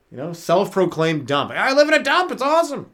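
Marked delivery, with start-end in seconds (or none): 1.5-2.9 very high, delighted boast; proud hoarder. Pretend. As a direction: brag about the dump, way up.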